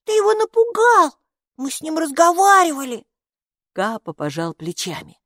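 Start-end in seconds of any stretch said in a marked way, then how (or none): 0.0-3.0 very high, loud protesting yell; angry scream. A narrator's reading. Майки blames Капо for scaring the robe, high outraged yell. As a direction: yell the accusation like an outraged girl. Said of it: "закричала Майки".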